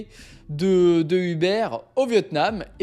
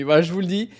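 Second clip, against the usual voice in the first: usual speaking voice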